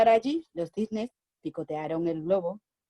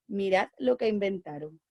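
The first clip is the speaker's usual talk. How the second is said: ordinary delivery, nothing marked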